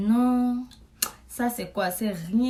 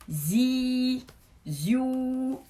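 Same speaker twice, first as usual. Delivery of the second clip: phone buzz imitation; 4 semitones higher than usual. Mon téléphone vibre.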